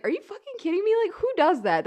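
high-pitched, incredulous mock outrage; retold anger. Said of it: "So I was fucking mad".

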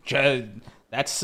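ordinary talk, nothing marked

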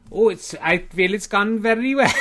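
put-on loud, high-pitched comic Welsh accent; terrible Welsh accent. Welsh player answering how it's gone, terrible Welsh accent. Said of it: "the terrible accent you're doing".

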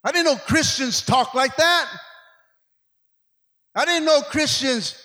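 loud, driving sermon delivery; preaching at full force. Acted. Pastor urging the church to crucify the flesh, fervent preaching. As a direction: preach it hard to the congregation.

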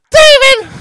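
high, loud falsetto shriek; shrieking wife. Acted. Wife shrieking her husband's name, outraged.

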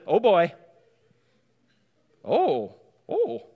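a hint of a Minnesotan "Oh, oh". Shown as a demonstration. Pastor joking to the congregation in a Minnesotan accent. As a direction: Minnesotan "oh" with a sudden high leap. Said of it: "Trying to get my best Minnesotan voice in there".

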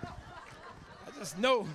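loud comic shout, words punched out; joking shout. Real, not acted. Preacher hollering a Space Jam joke at the congregation, loud and comic. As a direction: holler it for the laugh.

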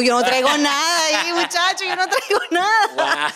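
high, loud, laughing denial; laughing protest. Retold or imitated. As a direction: insist you brought nothing, laughing. Protesting her innocence, laughing.

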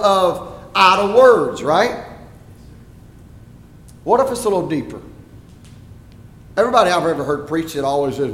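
ordinary delivery

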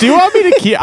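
laughing. Real, not acted. Teasing a friend about his quote, laughing. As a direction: crack up while asking.

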